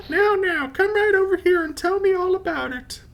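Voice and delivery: put-on very high, sweet old granny; grandma voice. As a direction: squeak it out like a doting grandma. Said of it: "why is my Granby Southern?".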